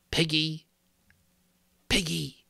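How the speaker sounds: a creepy voice luring from outside; horrible whisper. A narrator's reading. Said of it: "A voice whispered horribly outside".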